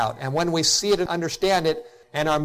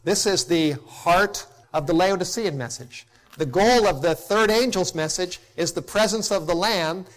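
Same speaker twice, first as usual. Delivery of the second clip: ordinary talk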